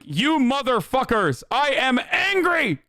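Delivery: high-pitched, over-enunciated mock anger; hammed-up anger, every word said in full; mock Tom Cruise yelling. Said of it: "he is saying all of the words fully".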